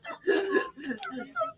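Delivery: very high, soft, teary laugh. Real, not acted. Laughing till tearful after answering reporters. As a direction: dissolve into laughter.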